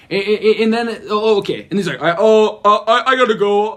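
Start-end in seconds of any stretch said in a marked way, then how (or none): 2.0-3.7 louder, stammering mock panic; imitated freaking out. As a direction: stumble over an excuse in a fluster. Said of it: "like, freaking out".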